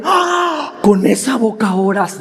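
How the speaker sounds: hammed-up horrified "Ah!", dropping into reproach; mock shocked. Preacher mimicking a God shocked at your questions, mock scandalized. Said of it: "no se espanta y dice".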